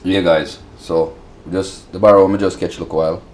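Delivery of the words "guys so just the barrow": ordinary talk, nothing marked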